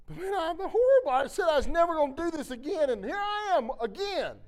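loud, high-pitched self-reproach; distressed protest. Retold or imitated. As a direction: argue back in exasperation, acting it out. Acts out protesting to God.

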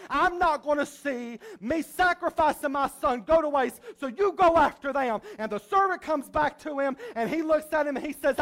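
ordinary talk, nothing marked

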